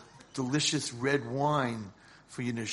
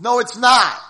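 put-on drunken denial; 9 semitones higher, 12 dB louder than usual. Drunk man denies it.

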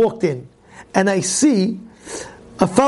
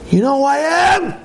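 high, imitated indignant yell; mock screaming; 9 semitones higher than usual. Stranger confronting a shopper in Target, mock yelling. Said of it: "Starts screaming in Target".

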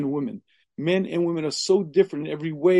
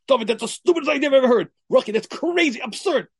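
high, loud, fast put-down; insulting spouse; 8 semitones higher, 5 dB louder than usual. Retold or imitated. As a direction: sneer at your partner's idea. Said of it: "couples say things to each other".

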